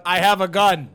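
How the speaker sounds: loud robotic announcement; automated voice. Acted. Doorbell warning whoever is on the porch, automated voice. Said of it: "an automated voice will come out".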